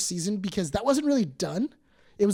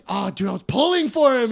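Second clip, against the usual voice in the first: loud, higher-pitched lament; dismayed protest; 4 semitones higher, 8 dB louder than usual. Retold or imitated. Telling friends about a favorite character's fate, dismayed.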